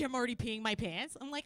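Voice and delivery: usual speaking voice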